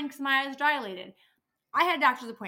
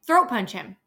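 louder angry echo; 9 dB louder than usual. Real, not acted. Louder repeat.

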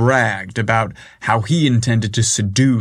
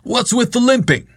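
higher, mocking boss call; teasing boss voice; 12 semitones higher than usual. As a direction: tease him about the limp. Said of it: "Chad called out".

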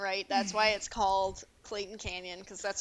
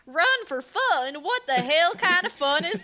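put-on old-timey drunk cowboy; 6 semitones higher, 9 dB louder than usual. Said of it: "Later on, he goes".

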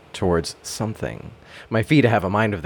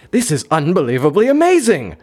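higher and louder, full of awe; rejuvenated wonder; 10 semitones higher, 5 dB louder than usual. Acted. Customer tasting perfect coffee, awed wonder. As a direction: gush in delighted amazement. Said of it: "I say in rejuvenated wonder".

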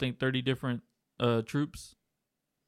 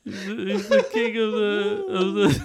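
laughing so hard he snorts; high squealing laughter, real; snorting laughs; 14 semitones higher, 7 dB louder than usual. Real, not acted. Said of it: "did you just snort".

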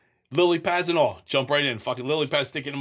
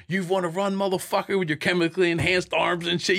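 higher, mocking cop voice, lightly voiced; taunting cop; 5 semitones higher than usual. Retelling cops taunting him, a lightly voiced higher mocking jeer. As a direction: sneer it at him like a cop mocking a cuffed man.

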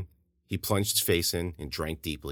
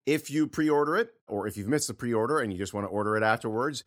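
higher, upbeat host plug; podcast promo read; 11 semitones higher than usual. Real, not acted. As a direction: sell the book to listeners.

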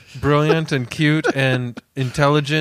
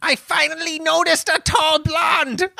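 high, ranting mock shout; hammed-up Hitler shout; 14 semitones higher than usual. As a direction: proclaim it as a raving caricature. Acting Hitler in the joke.

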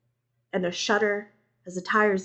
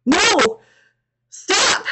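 high, loud protest; desperate shouting; 12 semitones higher, 9 dB louder than usual. A narrator's reading. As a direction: scream while being dragged away. Dragged away, inconsolable.

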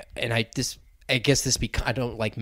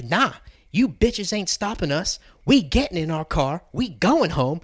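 defiant; 6 semitones higher, 4 dB louder than usual. Retold or imitated. Retold defiant Karen.

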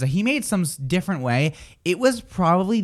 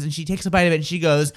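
ordinary talk, nothing marked

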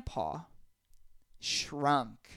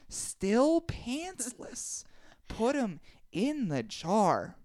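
slow; 6 semitones higher, much slower than usual. Real, not acted. Comic slow emphasis.

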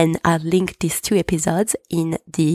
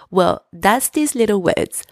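usual speaking voice

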